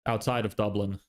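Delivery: ordinary talk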